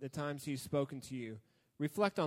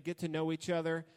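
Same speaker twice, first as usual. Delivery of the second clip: ordinary talk, nothing marked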